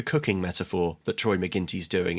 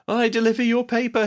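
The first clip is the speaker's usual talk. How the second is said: lightly voiced nervous boy, pitched up high; high mock Max voice; 12 semitones higher than usual. Podcaster voicing Max meeting Jenna, high and squeaky. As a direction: squeak it out like a smitten kid. Retelling Max's lines in film.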